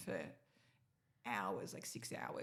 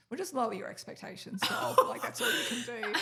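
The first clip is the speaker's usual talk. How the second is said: dry quip; 3 semitones higher, 7 dB louder than usual. Retold or imitated. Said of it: "That's such a dry sense of humor".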